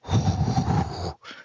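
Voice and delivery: a long, breathy release of adrenaline; big exhale. Retold or imitated. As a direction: let out a heavy, shaky-free sigh of relief. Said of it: "I'm like".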